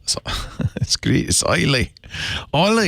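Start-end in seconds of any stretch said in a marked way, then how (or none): 1.3-2.9 Islay as "oily" in a mock Scottish brogue; playful try at Scottish "oily"; attempted Scottish brogue. Shown as a demonstration. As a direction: have a go at a Scottish brogue, higher-pitched. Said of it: "without the brogue".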